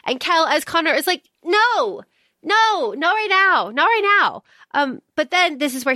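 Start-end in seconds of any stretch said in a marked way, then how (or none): 1.4-4.4 high, hurried no, no, not right now; panicked refusal. Retold or imitated. Kel as Connor fending off Falconet, high panicked refusal. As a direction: dodge him in a higher-pitched panic. Kel dodging a private talk.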